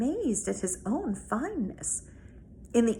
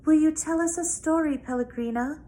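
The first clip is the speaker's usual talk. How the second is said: put-on higher, louder child asking; a child begging for a bedtime story; girl pleading with her grandmother at bedtime; eager little girl; 6 semitones higher, 4 dB louder than usual. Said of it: "Abilene asked her grandmother".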